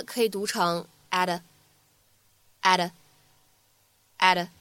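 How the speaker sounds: "at" linked to "a" with an American flapped t, said slowly; American flap demo. Shown as a demonstration. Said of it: "咱们在美式发音当中呢". (美式闪音慢读示范)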